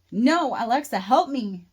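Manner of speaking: put-on loud, frightened child screaming; terrified scream. A narrator's reading. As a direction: cry out for help in terror. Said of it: "starts crying and screaming in terror".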